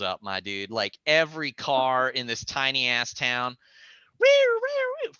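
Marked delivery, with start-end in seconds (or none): 4.2-5.1 high wailing siren noise; siren imitation. Imitating sirens coming up the mountain. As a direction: mimic police sirens. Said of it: "sirens going".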